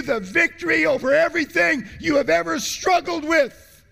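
fiery preaching; preaching at full force. Acted. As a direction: preach it out, big and high. Preacher building to a climax.

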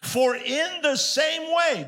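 very slow, weighty preaching of the verse; strong, slow scripture reading. Shown as a demonstration. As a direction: lean on every word, slowly, like a warning. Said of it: "he's gonna get real strong here".

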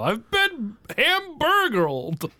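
high, drawn-out cry of outrage; play-acted victim calling out in high pitch; mock yell. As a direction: wail it slowly as a staged complaint. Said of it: "and I yell".

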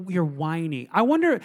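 normal talking voice

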